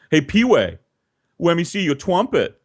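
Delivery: a kid's lisp, lightly mimicked; asking for the trumpet with a mild imitated lisp. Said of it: "that's how he sounded".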